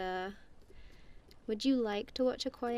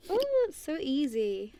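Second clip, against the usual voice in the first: delighted; 6 semitones higher than usual. Real, not acted.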